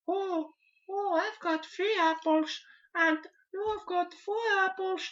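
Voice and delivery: put-on very high, slow Mexican child voice; a child's high Mexican accent, puzzling over apples; young Mexican kid accent. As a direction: squeak it out like a small Mexican child asking for help.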